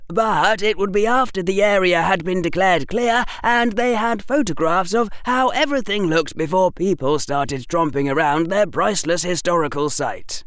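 eager, know-it-all pupil; precocious schoolboy. Acted. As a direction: lift the voice, explain it all very sensibly. Narrator voices Harry laying out plan.

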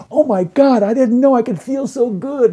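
high, loud, thrilled exclamation; retelling an excited fan, high and loud; overjoyed gushing. Retold or imitated. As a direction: gush like it changed your life. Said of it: "they go".

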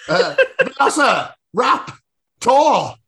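slow, high, laughing dinosaur announcement; impactful intro. Acted. Tom announces dinosaurs for an impactful start, slow. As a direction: drag out the name for drama. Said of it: "Make it impactful".

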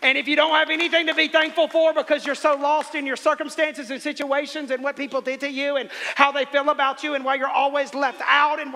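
loud, high building sermon; high, loud preaching run; full-force preaching. Acted. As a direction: drive the point home to the crowd.

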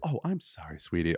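lightly voiced sweet Southern apology; Southern politeness. Mocking Southern politeness to a stranger, soft and sweet. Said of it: "there it was people".

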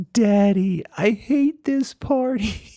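put-on high, drawn-out child's wail; mock crying child. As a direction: imitate a tearful kid complaining to Daddy. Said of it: "and he's crying".

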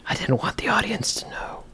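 secretive stage whisper; conspiratorial hushed aside. Pretend. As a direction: whisper it as if hiding from listeners. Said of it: "Why are we whispering".

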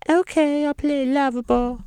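very high, sustained falsetto; mock pop-star voice, squeaked way up high. Pop star agrees to play the game, in falsetto.

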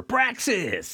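high, hammed-up villain shout; mock dramatic cry. Recapper hailing a returning villain, mock dramatic cry. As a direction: call the name out with mock menace.

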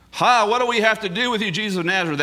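high, loud demonic cry; demon screaming. Retold or imitated. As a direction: shriek it as the cornered demon. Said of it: "So he screams".

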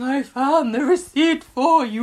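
singsong soap imitation; mock plaintive call. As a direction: send up the bedside line, stretched and high. Voicing Grace in hospital bed.

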